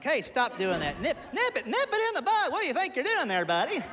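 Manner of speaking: high, squeaky mock-angry scolding; mock-angry nervous deputy, pitch way up; Barney Fife voice. Dad scolding kids, high like Barney Fife. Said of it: "sounds more like Bernard Milton Fife".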